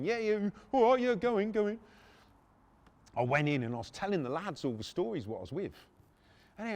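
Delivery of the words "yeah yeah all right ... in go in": high, louder imitation of him; acted hearty go-ahead; doorman relenting. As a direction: wave someone through in a raised voice.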